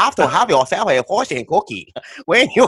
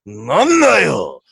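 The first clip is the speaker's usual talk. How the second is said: mock samurai shout; 2 semitones higher, 4 dB louder, slower than usual. The samurai shouting at the foreigner, hammed up. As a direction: ham up the angry samurai. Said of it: "maybe you want samurai".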